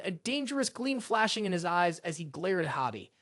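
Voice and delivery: usual speaking voice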